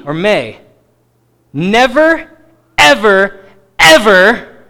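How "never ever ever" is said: NEVER, EVER, EVER hit loud, one word at a time; emphatic. Acted. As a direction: punch each word of "never, ever, ever" with rising force. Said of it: "said in an emphatic tone".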